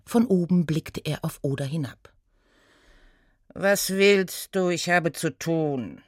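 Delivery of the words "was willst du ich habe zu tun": slow, drawn-out weary rebuff; dismissive centaur. Acted. Busy centaur to gnome, slow and dismissive. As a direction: brush the little one off slowly.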